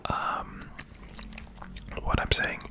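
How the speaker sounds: breathy close-mic whisper throughout. Real, not acted.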